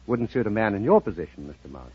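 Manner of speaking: ordinary talk, nothing marked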